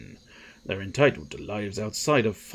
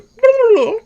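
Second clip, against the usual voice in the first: loud, high trilled squeal; very high imitated animal cry; lizard noise; 12 dB louder than usual. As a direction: shriek like a silly creature. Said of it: "did she go".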